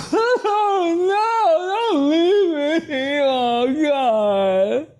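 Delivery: high-pitched wailing actor sob; hammed-up crying. Actor faking tears on cue at "Action", melodramatic wail. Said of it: "and you start crying".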